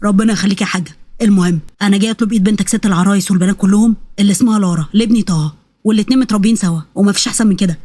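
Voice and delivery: usual speaking voice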